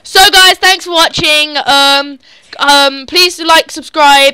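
loud, upbeat sign-off to viewers; vlog outro. Acted. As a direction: announce it like a YouTuber. Outro announcer voice.

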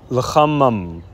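'lachamam' with throaty ch, closing on m; Hebrew pronunciation. Shown as a demonstration. Teacher demonstrates masculine 'their food', careful Hebrew 'lachamam'. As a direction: sound out 'lachamam', final vowel before the m. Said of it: "I'm gonna say it in the Hebrew".

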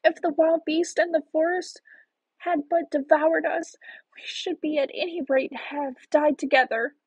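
put-on high-pitched, plaintive little girl; lamenting. A narrator's reading. Gretel grieving for herself and Hansel, plaintive. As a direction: lament in a high, helpless child's voice. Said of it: "how the poor sister did lament".

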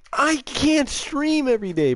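high-pitched, whiny pleading; exasperated protest. Real, not acted. Streamer answering chat's demand for daily streams, exasperated.

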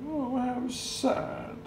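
gentle storyteller aside, slow; sympathetic. A narrator's reading. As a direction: sigh it with pity. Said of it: "narrator comments".

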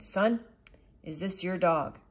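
loud gruff stranger; a hint of a scowling giant; stern big man. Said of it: "said the terrible man".

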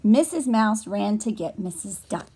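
usual speaking voice

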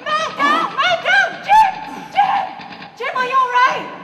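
loud, high battlefield yelling; frantic shouting. Pretend. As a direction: scream names across the battlefield. Calling fallen comrades in battle.

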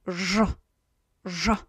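"ż" as a hard zh, like French bonjour; pronunciation demo. Shown as a demonstration. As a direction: buzz "ż" firmly on its own. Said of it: "Almost like from the French".

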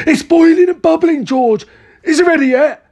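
very high, loud, impatient excitement; excited cry. A narrator's reading. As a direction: exclaim it high, bursting with impatience. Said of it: "cried Mister Cranky".

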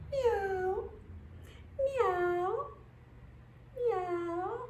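high meows swooping up at the end; cat meow. Shown as a demonstration. Instructor answering what the cat says, high rising meows. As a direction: mew questioningly like a kitty. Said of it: "what does the cat say".

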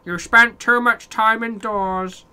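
high, drawn-out mock nagging; mocking game message. Gamer parroting the game's cabin fever warning, mocking. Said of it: "Mate, I've spent too much time indoors".

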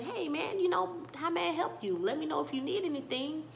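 a hint of a chatty old shop owner; friendly shopkeeper. Older shopkeeper greeting customer.

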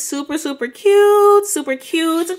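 high, loud delighted gush; excited. Real, not acted. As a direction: squeal it up high and bright. Gushing over finished scrunchies.

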